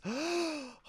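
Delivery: high, sharp fake gasp of shock; mock gasp. Reacting to the judge calling slang disrespectful, a mock gasp. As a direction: gasp as if scandalized, tongue in cheek.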